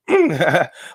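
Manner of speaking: short burst of laughter. Real, not acted. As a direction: laugh out at the memory. Laughing at story.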